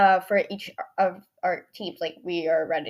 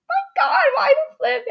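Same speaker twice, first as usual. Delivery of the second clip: high, loud squeal of disbelief; mock outrage; 8 dB louder than usual. Girl retelling being dropped by her friend, mock outrage. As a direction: squeal in comic shock.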